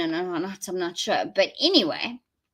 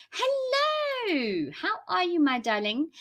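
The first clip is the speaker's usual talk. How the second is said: sweet greeting; 9 semitones higher, slower than usual. Acted.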